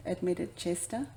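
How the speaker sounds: usual speaking voice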